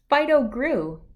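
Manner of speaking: usual speaking voice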